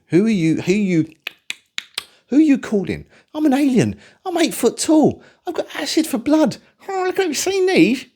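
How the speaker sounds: high, rising, half-laughing monster brag; alien voice. Retold or imitated. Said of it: "that's my alien impression".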